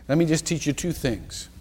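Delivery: ordinary talk, nothing marked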